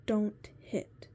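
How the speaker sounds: put-on firm, loud warning to a toddler; stern parent. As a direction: snap a sharp no at a child. Telling a toddler off.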